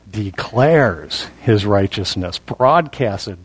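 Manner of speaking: slow down and drop low, stretching "declares"; stress on declares. Shown as a demonstration. Preacher answering the critics, low deliberate stress on "declares". Drives home the key word.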